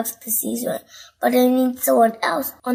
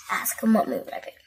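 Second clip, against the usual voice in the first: ordinary delivery, nothing marked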